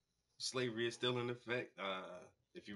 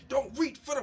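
much louder, higher yell that gets cut off; the same warning, now a cut-off panicked yell; panicked shout; 13 semitones higher, 13 dB louder than usual. Retold or imitated. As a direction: shout it in panic and break off mid-line. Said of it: "When the man say".

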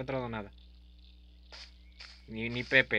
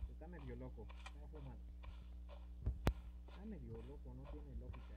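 hushed aside; 19 dB quieter, faster than usual. Real, not acted. Comentario en voz baja sobre el mono.

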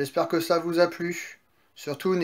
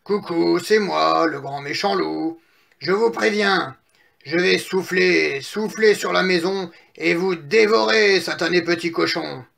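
big bad wolf; 3 dB louder than usual. Wolf threatens piglet in straw house, playful menace.

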